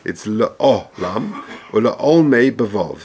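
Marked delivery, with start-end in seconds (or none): 0.3-1.4 "La'olam" very slowly, "la-O-lam" with a kamatz under the ayin; careful pronunciation. Shown as a demonstration. Teacher repeating the word slowly for students. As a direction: stretch out "La'olam" deliberately, each vowel distinct. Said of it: "Bekomatz Tachas Ha'ayin".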